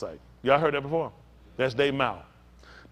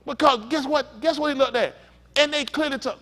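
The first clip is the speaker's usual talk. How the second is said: loud, high-pitched preaching; full-force preacher delivery; forceful preaching; 8 semitones higher, 4 dB louder than usual. Real, not acted. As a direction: hammer out the story to the congregation.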